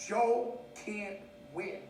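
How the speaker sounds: "Joe ... can't ... win", each word separate; very slow word-by-word "Joe can't win"; slow, spaced-out emphasis. Real, not acted.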